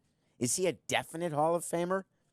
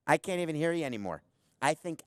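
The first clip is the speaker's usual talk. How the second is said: normal talking voice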